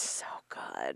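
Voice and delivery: whispered it's so good. Real, not acted. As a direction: breathe it out softly. Defending the show.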